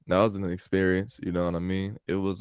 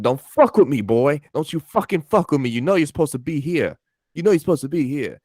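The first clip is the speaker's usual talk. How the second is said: higher, louder mock tirade; imitating angry boss; 7 semitones higher, 5 dB louder than usual. Pizza boss refusing the nephew's day off, mock angry yell. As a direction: chew out the nephew in a hammed-up boss voice. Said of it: "He said just like that".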